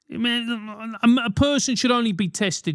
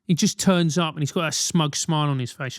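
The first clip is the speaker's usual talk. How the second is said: usual speaking voice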